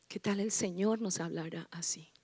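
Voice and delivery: hushed rhetorical question; whisper. Acted. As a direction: ask it in a whisper. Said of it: "susurro en la prédica". (susurro de pregunta)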